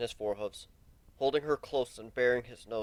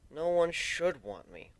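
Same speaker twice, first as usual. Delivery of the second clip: disgusted; 6 semitones higher, slower than usual. Acted. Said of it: "wrinkling his nose with disgust".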